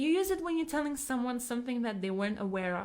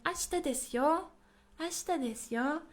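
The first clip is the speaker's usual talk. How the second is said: higher pitch, "yo" rising at the end; emphatic informing; 6 semitones higher than usual. Shown as a demonstration. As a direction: lift "yo" to press the point. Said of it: "So you're putting emphasis".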